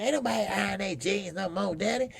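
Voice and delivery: high mocking kid voice; son's voice. Son telling his father nobody irons jeans, high mock voice. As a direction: pipe it up like a know-it-all son. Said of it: "they say".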